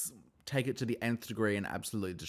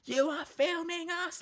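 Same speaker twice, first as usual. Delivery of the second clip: much higher, slow, shouted imitation of a cast member; mock yell; slower than usual. Recapping a housewife yelling at filmers in the van, mock yell. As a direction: yell it slowly, way up high, repeating it. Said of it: "yelling at Brittany in the van".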